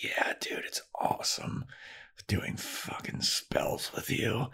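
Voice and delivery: breathy whisper, very slow at the end. Retold or imitated. Willow confiding in Tara about their spells, whispered and slow. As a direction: whisper it close, like a secret crush.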